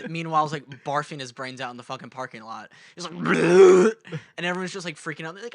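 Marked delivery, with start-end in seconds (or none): 3.2-3.9 loud mock retch; a friend imitating someone vomiting; retching sound. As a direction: imitate throwing up. Brother vomiting in parking lot.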